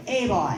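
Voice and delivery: put-on "Hey, boy" said loud and falling, the Newfoundland way; Newfoundland phrase. Shown as a demonstration. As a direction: model "Hey, boy" for them to copy. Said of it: "So repeat after me".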